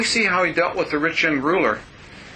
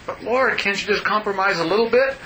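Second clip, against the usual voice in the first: higher, wheedling appeal; pleading to bargain; 5 semitones higher than usual. Acted. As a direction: coax for a small concession, pitched up. Acting out bargaining with the Lord.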